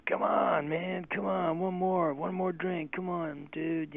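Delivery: high, drawn-out pleading to keep drinking; pushy drinking buddy. Retold or imitated. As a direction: egg a friend on like an insistent drinking buddy. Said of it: "one of those guys that says".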